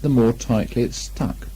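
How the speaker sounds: usual speaking voice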